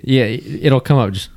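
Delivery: ordinary talk, nothing marked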